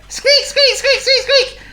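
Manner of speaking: volley of very high, loud squeaks; exclaiming mice. A narrator's reading. Mouse family roused by the hullabaloo, excited squeaks. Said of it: "exclaimed Mister and Missus Mouse".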